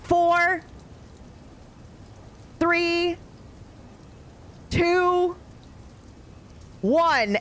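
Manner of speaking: slow, held numbers with long gaps; imitated sleep countdown. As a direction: count down aloud like a sleeper, level and sustained. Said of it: "counting backwards in your sleep".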